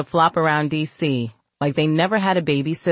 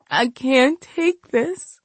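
slow, broken, high voice; tearful despair; 9 semitones higher, slower than usual. Acted. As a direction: let it crack in despair. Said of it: "She continued the pity party".

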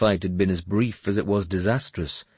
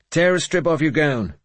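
raised, forceful command; ordering; 9 semitones higher, 5 dB louder than usual. A narrator's reading. Finan orders the girl to make a bandage, commanding. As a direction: order it loudly, pitched up. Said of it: "Finan ordered her".

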